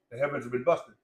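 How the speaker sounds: normal talking voice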